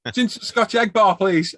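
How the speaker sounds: high, sing-song fake politeness; mock-innocent request. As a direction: sweetly wind the shopkeeper up. Mocking the shopkeeper with extra orders.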